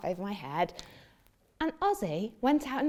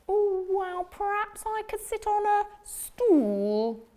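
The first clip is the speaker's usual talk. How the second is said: hopeful frog; 11 semitones higher than usual. Acted. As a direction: ask brightly hoping for yes. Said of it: "said the frog".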